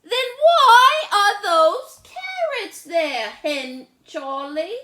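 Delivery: very high, loud, slow indignant question; fussy little sister. Acted. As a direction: demand an answer like an outraged little girl. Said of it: "Lola asks about carrots".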